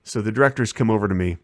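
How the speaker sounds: usual speaking voice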